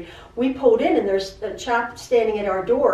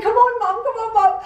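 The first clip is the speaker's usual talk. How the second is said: high, laughing plea of an excited son; son pleading; 11 semitones higher than usual. Retold or imitated. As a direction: beg mom to come out, giggling. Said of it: "he's like".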